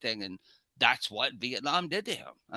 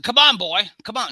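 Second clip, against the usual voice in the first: put-on loud, higher call of come on boy; challenging shout; 8 semitones higher, 9 dB louder than usual. Uncle Bobby daring the reckless driver, loud challenging shout. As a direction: dare him to come out and fight. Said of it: "I said".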